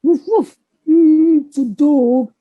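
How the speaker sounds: loud woofs and a hum; pretend dog. Shown as a demonstration. Teacher pretending to be a dog for children, dog voice. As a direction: bark it like a playful dog. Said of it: "or pretend being a dog".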